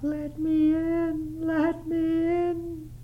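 very high, slow, thin pleading ghost voice; a lost child's ghost begging at the window; ghostly child pleading to be let in, high and drawn out; sobbing ghost child. Acted. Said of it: "a most melancholy voice sobbed".

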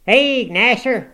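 high, very loud calls to dogs; full-voiced hound-rousing holler; old man's shout. A narrator's reading. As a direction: shout urgent commands to set the dogs on him. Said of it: "shouted the ancient".

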